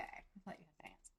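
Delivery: breathy whispered aside; under the breath, unvoiced; whispering. Real, not acted. Muttered aside during outfit chat.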